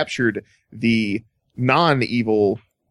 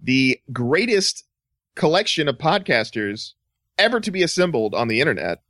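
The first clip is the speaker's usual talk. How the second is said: ad read; 4 semitones higher than usual. Acted. Promo for sister show.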